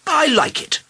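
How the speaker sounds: very high, gleeful royal voice; delighted king. A narrator's reading. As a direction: pipe up in high-pitched defiance. King defies his doctors.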